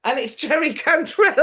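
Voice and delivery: ordinary talk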